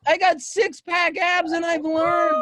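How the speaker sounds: high, loud and drawn-out brag; jubilant shouted boast. Real, not acted. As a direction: shout it proudly over the laughter. Boasting loudly over co-host's laughter.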